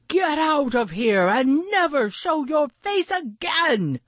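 high, forceful duck voice; Maxwell angry. A narrator's reading. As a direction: order the bully away indignantly. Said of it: "Maxwell said, staring right into Snout's eyes".

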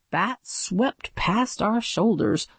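ordinary delivery

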